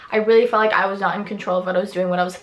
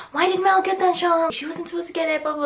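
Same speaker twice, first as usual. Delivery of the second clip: high, far-off mock mom voice; imitating worried mom; 8 semitones higher than usual. Vlogger imitates her mom calling about the shot, high and fretful. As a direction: mimic mom fretting on the phone.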